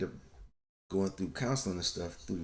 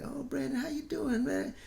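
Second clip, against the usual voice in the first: put-on much higher, sing-song talk to a little kid; sweet coaxing adult. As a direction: coo at a shy child to get a word out. Relatives coaxing shy child.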